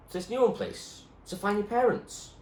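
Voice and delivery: put-on boy Munchkin.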